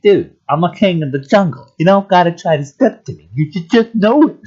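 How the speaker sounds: high, loud, cocky put-on voice of a big shot; king of the jungle. Telling passing guys he is king, high cocky voice. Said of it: "and I'm just like".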